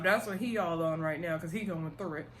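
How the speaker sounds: ordinary talk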